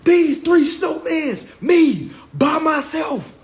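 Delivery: loud, high boast, stretched slow; bragging shout. Retold or imitated. Bragging to everyone about the three snowmen. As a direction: show off the snowmen at full volume. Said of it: "I'm about to talk my shit".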